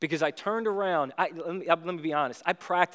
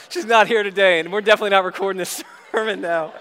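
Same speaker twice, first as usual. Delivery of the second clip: laughing joke; 2 semitones higher, 7 dB louder than usual. Real, not acted. Joking about his wife hearing.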